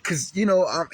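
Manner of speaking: normal talking voice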